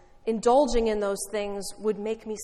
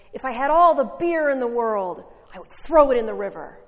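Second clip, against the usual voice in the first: loud preaching, pounding the words; preacher shouting; 7 dB louder than usual. Retold or imitated. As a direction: proclaim it from the pulpit. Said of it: "And getting more fired up".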